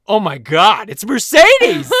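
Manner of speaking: loud, high excited exclamation; sudden recognition. Retold or imitated. Host retelling the moment she spotted the actress, excited shout. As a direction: re-enact a sudden shocked realization, shouting it high. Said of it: "all of a sudden I was like".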